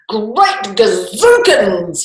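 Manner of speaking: king bellowing at the sight of a dragon; king's cry. A narrator's reading. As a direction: cry it out in amazement. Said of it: "he cried when he caught sight".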